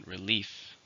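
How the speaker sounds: "Relief" stressed on "lief"; second-syllable stress. Shown as a demonstration. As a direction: model "Relief" softly, weight on the second syllable. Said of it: "stresses on the second".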